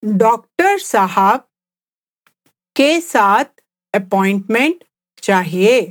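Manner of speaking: slow phrase-by-phrase Hindi with clear pauses. Shown as a demonstration. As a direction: say it slowly for learners, pausing between chunks. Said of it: "let's just speak at a slower pace".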